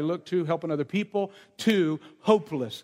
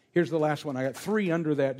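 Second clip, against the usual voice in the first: normal talking voice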